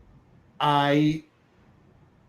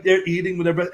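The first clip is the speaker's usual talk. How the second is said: normal talking voice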